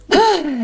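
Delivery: big worn-out exhale; relieved sigh. Real, not acted. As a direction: let out a heavy sigh. Relief after a long rant.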